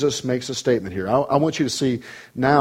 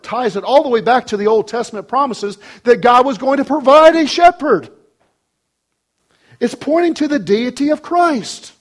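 loud, high, ringing proclamation; full-force preaching; 10 semitones higher, 6 dB louder than usual. Real, not acted. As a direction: drive it home to the whole congregation.

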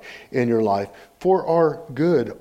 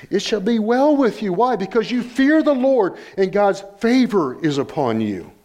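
high, driving pulpit proclamation; raised preaching voice; emphatic preaching; 8 semitones higher than usual. Real, not acted. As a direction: proclaim the blessing to the congregation.

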